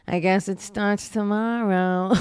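a slow, offhand line in her voice; Fran Drescher impression. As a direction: act like she just remembered the strike. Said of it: "So she was like".

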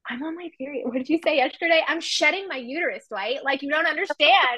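high-pitched mock upset rant; imitating upset friend. Upset wife complaining to her husband, imitated exasperation. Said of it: "And she was so upset".

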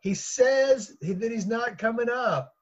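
high, drawn-out call. Acted. Daughter telling mother he refuses, calling out. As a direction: call the message up slowly. Daughter relaying messages up the stairs.

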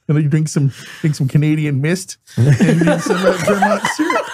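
put-on laughing Canadian accent. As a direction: talk through a jutted jaw. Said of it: "my jaw, my split lip".

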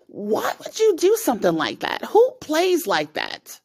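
high-pitched outraged questioning. Retold or imitated. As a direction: demand it in disbelief. Outraged at friend's prank.

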